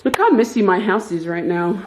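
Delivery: ordinary delivery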